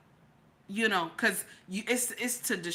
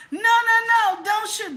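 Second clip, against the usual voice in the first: much higher and louder panicked "no, no"; frantic mock plea; 9 semitones higher, 7 dB louder than usual. Citizen leaping in front of the robber pleading with police, much higher and louder panicked plea. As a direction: shriek it like you're jumping in front of a gun.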